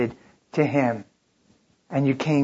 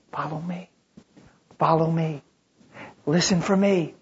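gentle invitation. Acted.

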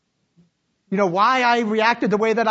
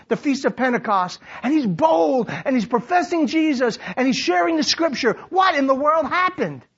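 high, driving sermon delivery; rousing, declamatory preacher; full-force preaching; 8 semitones higher than usual. Real, not acted. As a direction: preach it out to the whole room.

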